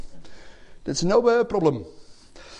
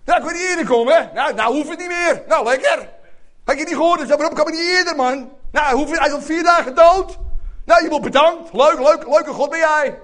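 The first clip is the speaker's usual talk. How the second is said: sarcastic grumbling; 6 semitones higher, 3 dB louder than usual. Retold or imitated. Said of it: "Ik kreeg voortdurend allerlei commentaar".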